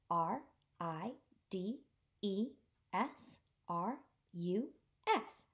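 ordinary delivery, nothing marked

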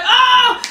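very high imitated squeal; high, drawn-out acted "ah"; mock losing-it scream. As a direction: re-enact a fangirl scream. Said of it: "I lost it".

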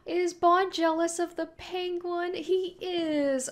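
high, slow, astonished question; surprised. Real, not acted. Viewer astonished the dog is jealous, high and slow. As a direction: gasp out the question in disbelief.

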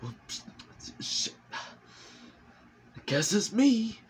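drawn-out, high, defeated sigh of a voice; slow, high, resigned grunt voicing a doomed henchman; resigned reluctant soldier. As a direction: play the last guy who knows he's stuck with it.

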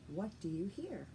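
ordinary talk

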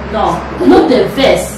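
No no the verse said slowly; slow it right down; carefully slow correction; slow and deliberate. Shown as a demonstration. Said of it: "slow, slow".

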